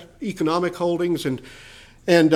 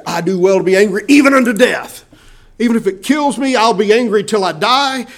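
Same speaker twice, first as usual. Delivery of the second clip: loud, high furious outburst; hammed-up rage at God; angry Jonah; 4 semitones higher, 7 dB louder than usual. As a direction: shout it like a prophet who won't back down. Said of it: "he said".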